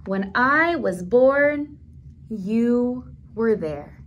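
slow, tender storybook reading; reading to children. Acted. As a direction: read each line slowly to little listeners. Reading picture book text.

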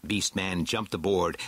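ordinary delivery, nothing marked

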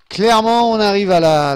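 loud, high-pitched declaration easing down; emphatic exclamation. Real, not acted. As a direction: burst out with it, then come down. Announces the end loudly to viewers.

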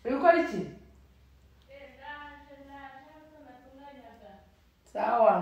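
high, loud call to another room. Real, not acted. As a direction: call out to her. Calling out to mom.

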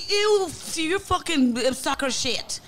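high, squealing mock insult; whiny mocking voice. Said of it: "And I used to be like".

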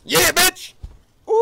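very high, loud victory scream; triumphant yell. Real, not acted. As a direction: scream it over the kill. Taunting after a kill.

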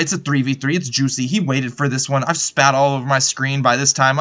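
usual speaking voice

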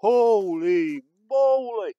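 loud, drawn-out holy moly; amazed exclamation. Real, not acted. Amazed at a thick tree.

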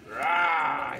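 low, drawn-out growly "rah"; troll growl. Pretend. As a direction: pretend monster growl, low and held. Troll introduced with a roar.

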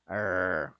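low growled "rrr", like a dog about to bite; English R sound. Shown as a demonstration. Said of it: "como cuando el perro te va a morder".

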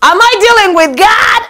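high, loud disbelieving question; mock confused outburst. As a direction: act baffled by the two voices. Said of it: "then you're like".

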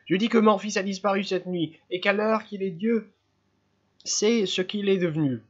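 high, tense mother's voice; agitated mother. Acted. Mother insisting to the inspector that her son is gone, high and tense.

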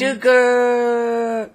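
loud high call across distance. Real, not acted. Friend calling his partner across the map, loud high yell. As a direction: yell his name to get his attention.

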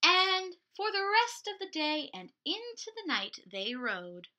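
put-on lively picture-book narration for little kids; storytime reading. A narrator's reading. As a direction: read it like a children's librarian. Picture-book read aloud to kids.

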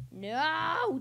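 loud, high, drawn-out "no"; frustrated yell. Real, not acted. Player who just died, frustrated yell. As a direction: cry out as you lose. Dies in game.

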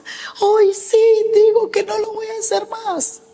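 high-pitched mock wailing; imitated crying. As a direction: imitate someone sobbing out a desperate plea. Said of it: "él llegaba y lloraba y me decía".

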